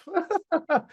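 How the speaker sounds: a sudden high burst of laughter; high cackle. Real, not acted. Laughing at his own Whoop chant, high cackle.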